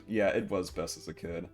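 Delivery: ordinary talk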